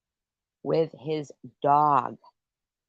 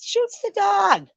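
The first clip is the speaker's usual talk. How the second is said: outraged disbelief; 5 dB louder than usual. Retold or imitated. Ranting to a listener about the dog being shot, high outraged slow cry. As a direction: pitch leaps way up, slow and louder.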